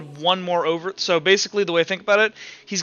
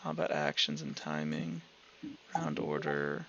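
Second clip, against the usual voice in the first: low, very quiet mutter while scanning a list; absently murmured search through the rules; muttering to oneself; 15 dB quieter than usual. Real, not acted. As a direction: read headings under your breath. Reads rule headings to self while searching.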